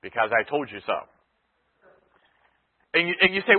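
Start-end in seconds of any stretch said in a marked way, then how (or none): 0.0-0.9 mock parent. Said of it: "And they responded with what?".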